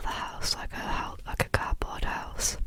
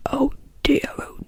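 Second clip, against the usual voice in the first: tutting Father Christmas; 5 dB louder than usual. Acted. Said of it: "tutted Father Christmas".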